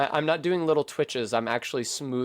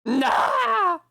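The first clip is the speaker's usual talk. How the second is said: shout; 4 dB louder than usual. Real, not acted. Reacting to a fall in the game.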